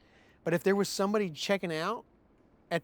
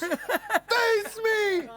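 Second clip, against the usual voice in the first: very high drawn-out mock yell; crazed challenge. Knife man challenging his taunter, imitated high holler. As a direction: squeal out the challenge, stretched long.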